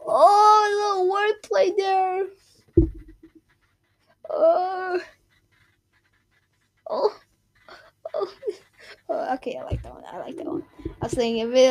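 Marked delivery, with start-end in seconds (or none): 0.1-8.3 high, excited oh; delighted. Real, not acted. Delighted reaction to joke. 9.1-10.6 whisper. Real, not acted. Whispered approval of joke.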